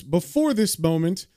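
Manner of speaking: ordinary delivery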